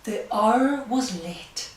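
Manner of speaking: slow, hushed storybook reading; reading to children. Acted. Reads picture book aloud.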